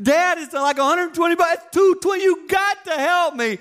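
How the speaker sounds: whining son. Retold or imitated. Son begging dad to pay, high whine. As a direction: plead like a broke teenager. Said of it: "son begs".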